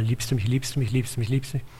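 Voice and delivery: rattled-off question, run together and soft; heruntergeleiert. Retold or imitated. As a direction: repeat it like a meaningless chant. Repeats the question as pointless rote. (schnell und leise heruntergeleiert)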